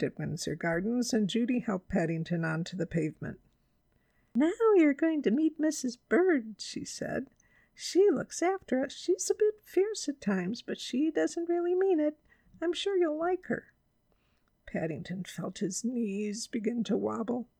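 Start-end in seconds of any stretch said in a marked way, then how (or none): 4.3-6.6 put-on high-pitched girl's voice; light, high, friendly girl; chatty girl Judy. A narrator's reading. 7.7-13.7 put-on high-pitched girl's voice; light, high, friendly girl; reassuring girl Judy. A narrator's reading.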